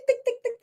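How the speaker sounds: light high ticking sound; imitated guitar tick. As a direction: tap out the guitar rhythm with your voice, high up. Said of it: "a little tick".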